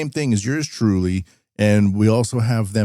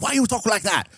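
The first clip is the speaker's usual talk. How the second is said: high, quick-fire Canadian-Chinese accent imitation; joking Canadian-Chinese accent, pitched up; mock Canadian-Chinese accent; 11 semitones higher, 3 dB quieter than usual. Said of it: "that's the Canadian Chinese accent now".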